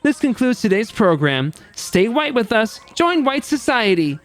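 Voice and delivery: high, theatrical showman with crowing delight; gleeful executioner host. Acted. Host carries out the execution and closes today's program, gleeful. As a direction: present the execution as cheerful entertainment.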